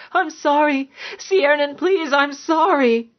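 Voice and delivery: high, scared begging; frightened pleading. A narrator's reading. Cowering woman apologising to her violent lover, pleading in fear.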